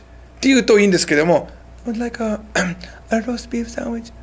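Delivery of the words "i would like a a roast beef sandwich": much softer, higher-pitched timid order; small, shy voice. Shown as a demonstration. Shy customer ordering at Subway, too quiet to be understood. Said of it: "大きい声で喋ってないだけで".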